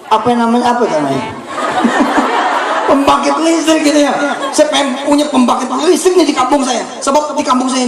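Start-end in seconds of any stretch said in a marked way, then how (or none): 1.3-2.9 high airless giggle; breathy giggle. Real, not acted. Preacher laughing at himself mid-prayer, airy giggle.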